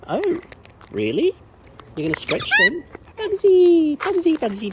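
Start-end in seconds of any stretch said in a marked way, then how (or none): 2.0-2.8 very high, loud incredulous question; surprised. Real, not acted. Surprised at the bird. 3.2-4.7 high, loud sing-song call; calling the bird. Real, not acted.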